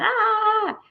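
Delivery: high burst of buzzing excitement; excited squeal. Retold or imitated. To her viewers, retelling yesterday's buzz, a high excited squeal. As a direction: let out a giddy squeal. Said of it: "like, buzzing".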